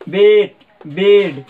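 बेट and बेड slowly, each short e clearly shaped; teacher slowing down to show the short e; modelling short e. Shown as a demonstration. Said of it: "ए आ रहा है ना".

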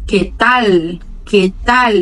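"que tal" with a clear final L, tongue on the palate; Spanish "que tal" with crisp L. Shown as a demonstration. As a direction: finish "que tal" with the tongue touching the roof of the mouth. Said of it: "com a língua tocando lá no céu da boca".

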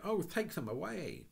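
high pleading cry; frightened high plea; horrified plea. Acted. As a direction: beg someone to remove the rats. Anthea pleading at rats.

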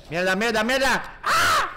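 high, loud terrified shriek; panicked scream. Real, not acted. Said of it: "perdón por el grito".

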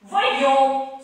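loud, high cackling witch. Acted. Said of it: "une voix de sorcière".

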